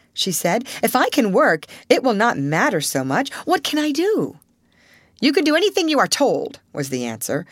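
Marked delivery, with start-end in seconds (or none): 0.8-4.4 rising hopeful eagerness; girl relieved she may work; eager relief. Acted. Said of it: "a faint gleam of light". 5.2-6.6 curt headmistress. Acted. Headmistress answers curtly.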